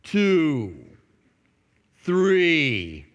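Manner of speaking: a hint of a mocking atheist counting slowly; counting really slow. Preacher mimics the atheist daring God, counting really slow. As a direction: drag out each number like a taunt. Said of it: "he would count from one to sixty, really slow".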